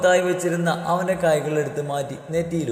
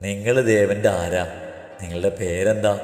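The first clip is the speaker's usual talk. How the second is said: lightly voiced man, low pitch; man inquiring; 8 semitones lower than usual. A narrator's reading. Man asks the girls who they are, lightly lowered voice. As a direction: ask in a lowered man's voice.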